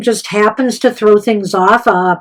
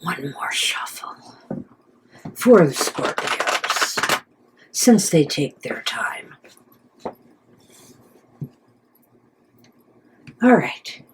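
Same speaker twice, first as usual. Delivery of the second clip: whispering; 7 semitones lower, 9 dB quieter than usual. Real, not acted. Whispering while shuffling the cards.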